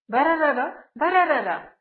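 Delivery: rolled-r motor noise, done twice; sewing machine whirr. A narrator's reading. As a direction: imitate a sewing machine with rolling r's. Imitates the running sewing machine.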